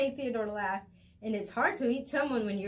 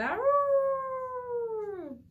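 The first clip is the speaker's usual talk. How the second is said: high, loud drawn-out wolf howl; long rising wolf cry; 5 dB louder than usual. Acted.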